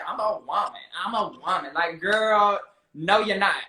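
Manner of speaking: ordinary talk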